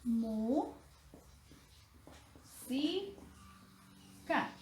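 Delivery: "Mu, si, ca" split into separate syllables with long pauses. Shown as a demonstration. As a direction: say "Mu" and "si" rising, pause, then close on "ca". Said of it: "Vamos a descomponerlas en sílabas".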